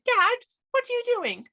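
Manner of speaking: a kid's mock-innocent greeting in a funny accent; funny little accent. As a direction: pop up high as the son who ruins everything. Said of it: "he likes to ruin everything".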